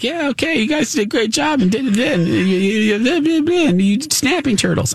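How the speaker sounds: high, casual put-on voice; airy imitation of a zookeeper; mock zoo guy. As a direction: lightly toss it off as the zoo guy. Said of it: "they're like".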